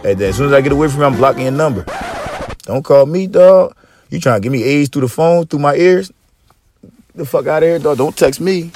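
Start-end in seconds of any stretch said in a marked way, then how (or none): none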